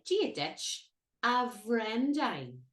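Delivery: very slow "Gia dich a Brendan", B H between W and V; Irish greeting demo. Shown as a demonstration. Teacher to learners, very slow model. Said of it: "we say".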